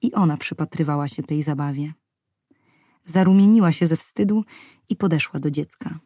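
ordinary delivery, nothing marked

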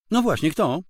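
wife turning on her husband; sharp wife. Acted. Wife rounding on her husband, sharply pointed.

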